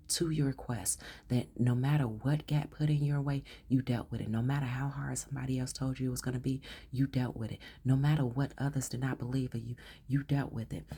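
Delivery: ordinary delivery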